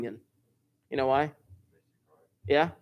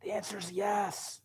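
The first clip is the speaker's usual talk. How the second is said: stage whisper the answer; hushed reveal to the class; 3 semitones higher, 12 dB quieter than usual. Pretend. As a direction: let the answer slip out as a secret. Teacher answers own question dramatically.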